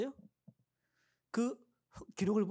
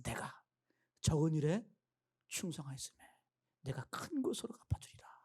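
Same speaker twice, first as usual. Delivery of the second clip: hushed whisper, softer with each phrase; the Lord speaking softly; 4 semitones lower, 4 dB quieter than usual. Acted. Pastor voicing the Lord's promise to the faithful, whispered. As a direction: breathe the promise like a secret. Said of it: "그때 주님 말씀하실 거예요".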